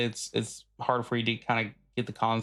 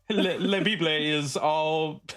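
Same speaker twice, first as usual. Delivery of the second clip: slow, mangled mock Latin accent; higher, hammed-up foreign accent; put-on foreign accent; 5 semitones higher, slower than usual. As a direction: fake accent, drawn out. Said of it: "incorporating this accent".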